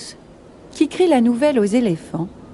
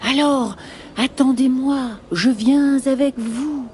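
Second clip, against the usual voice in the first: exclaiming snake; 4 semitones higher than usual. Acted. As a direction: stretch the words like a slithering snake. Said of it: "s'exclame le serpent".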